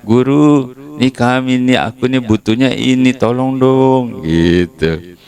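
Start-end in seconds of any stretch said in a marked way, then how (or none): 0.0-4.2 high whiny plea, slowing at the end; playful whiny ask; mock begging. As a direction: spell out your needs pleadingly. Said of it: "mestinya gitu dong".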